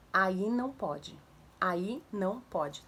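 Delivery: put-on slow, spaced-out words to a child; short clear command. Shown as a demonstration. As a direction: say each of the three words slowly, calmly. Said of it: "Três palavras". (comando curto, devagar, palavra por palavra)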